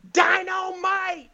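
very high, drawn-out imitated catchphrase shout; heckler's yell. Audience member yells at the star for a free shirt, hollering. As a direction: holler it like competing to be loudest. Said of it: "whoever yells Dynamite the loudest".

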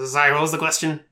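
mildly puzzled kobold; confused. Acted. Kobold turning confusedly to the group, confused. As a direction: ask as if you lost the thread. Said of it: "looking at the other confusedly".

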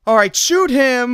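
high, loud and slow comic yell; goofy character yelling. Retold or imitated. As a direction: suddenly switch sides, shouting. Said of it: "He's like".

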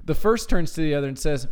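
ordinary talk, nothing marked

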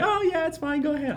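very high, falsely cheerful; high pitch. Retold or imitated. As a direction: chirp it out to hide annoyance. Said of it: "I really mean".

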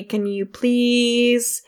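very slow, stretched words for suspense; drawn-out announcement. Pretend. Game master asks everybody for initiative, drawn-out announcement. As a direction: drag out each word as combat begins.

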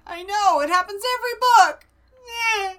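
loud, high, fast cry of frustration; exasperated outburst. Real, not acted. To her co-host about dead foxes, loud exasperated cry. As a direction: throw up your hands that it always happens.

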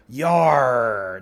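a loud, hammy yar; slipping back into pirate for the boss. Pretend. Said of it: "pirate again".

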